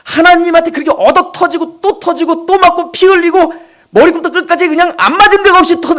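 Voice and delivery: normal talking voice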